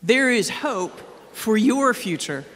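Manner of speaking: slow, plain call to repeat to a neighbor; a friendly spoken nudge to a neighbor; tell your neighbor. Shown as a demonstration. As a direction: say it as if to the person beside you. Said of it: "tell your neighbor".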